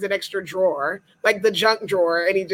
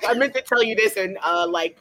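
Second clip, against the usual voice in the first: ordinary talk, nothing marked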